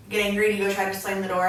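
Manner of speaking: ordinary talk, nothing marked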